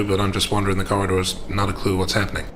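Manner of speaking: ordinary talk, nothing marked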